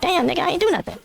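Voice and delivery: high-pitched, laughing plea of innocence; mock innocent protest. Storyteller retelling his reply to the homie, high mock protest.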